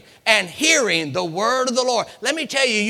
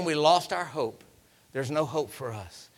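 sinking, low, fading lament; despondent; 4 semitones lower, 5 dB quieter than usual. Acted. Dry bones confessing lost hope, despondent sinking voice. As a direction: let the voice drop away softly.

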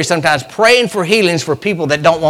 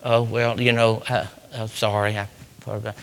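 meek apology; 6 semitones lower, 8 dB quieter than usual. Retold or imitated. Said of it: "they said".

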